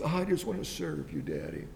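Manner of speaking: low, soft and meek; humble son. Retold or imitated. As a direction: drop low and hush, humbly offering. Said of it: "But the son's attitude was".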